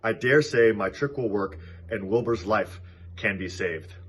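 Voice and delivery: usual speaking voice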